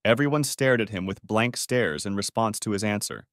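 ordinary delivery

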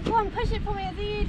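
soft coaxing. Real, not acted. Coaxing pony softly.

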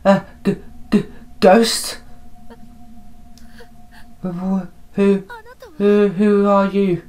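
high, thin-volume stutter; frightened stammer. Acted. Character meeting a ghost, frightened high stammer.

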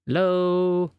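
long, searching phone call-out. Acted. Calling into a silent phone line.

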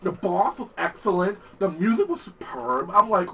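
ordinary talk

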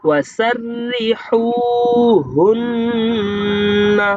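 slow melodic tajweed chant; Quranic recitation. Shown as a demonstration. Teacher recites the verse slowly for students to copy. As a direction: intone each word long and steady.

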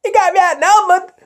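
mock shock. Toy figure aghast at being outnumbered by troopers. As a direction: squeak the question in alarm.

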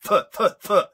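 "Th, th, th" fired out loud and high; emphatic TH sound. Shown as a demonstration. Host rants about diction, "Th" repeated loudly and pointedly. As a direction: hammer "Th" as separate TH sounds. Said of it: "We know how to pronounce th".

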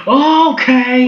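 high, loud, drawn-out surprise; startled okay. Real, not acted. Startled reaction in the game.

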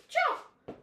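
high squeaky "Chop!"; chop sound effect. Pretend. Chopping vegetables, calling out.

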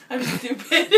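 giggling at herself; laughing. Real, not acted. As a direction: laugh through the words. Laughs at self.

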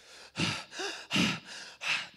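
sharp, breathy mock gasps; imitated gasping. Minister imitating a girl collapsed on the ground, gasping. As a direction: gasp in repeated bursts like someone overwhelmed. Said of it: "And then she started".

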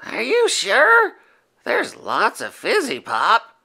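eager little monster; high childlike Yeti. A narrator's reading. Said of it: "said Furball".

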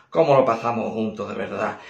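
usual speaking voice

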